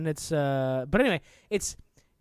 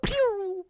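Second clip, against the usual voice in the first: mock laser pew. Playful sound effect.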